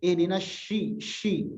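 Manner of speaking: Ihdinas shi with a sh in place of the s; wrong sh sound. Shown as a demonstration. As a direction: show the mistake, shi instead of si. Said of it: "Atau jadi shin".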